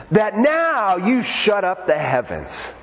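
slow, high mock-pious command; imitated showy praying, words stretched; super-spiritual friend. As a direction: drag out a grand prayer to the skies. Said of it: "he acts like he's super spiritual".

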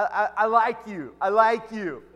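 loud retold protest of affection; emphatic insistence. Embarrassed guy reassuring the pastor, loudly insistent.

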